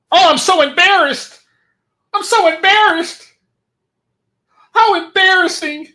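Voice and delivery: mock shrill whine, fluttering; mock whiny woman. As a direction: ham up a fussy woman clutching her pearls. Mocking the woman's embarrassment.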